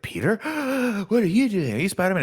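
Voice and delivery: high gasp then alarmed questions; a mock-shocked Harry discovering Peter. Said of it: "He's like".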